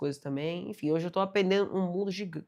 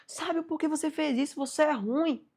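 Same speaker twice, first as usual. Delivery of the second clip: much higher, anguished accusation; desperate reproach; 10 semitones higher than usual. Retold or imitated. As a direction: cry out in disbelief at the master. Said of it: "o aprendiz ficou desesperado". (aprendiz desesperado acusando o sábio)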